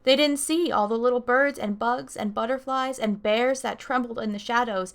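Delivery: put-on lively read-aloud for kids; storytime narration. Librarian reading a picture book to kids, lively storytime voice. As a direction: tell it to a room of children.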